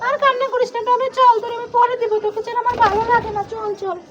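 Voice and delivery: put-on high, gentle little-kid voice fading away; coaxing child figure. Toy child coaxing Tommy down, in a high coaxing voice.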